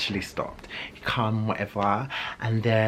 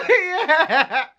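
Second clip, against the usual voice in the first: high giggle; giggly high laugh; 4 dB louder than usual. Real, not acted. Laughing at herself.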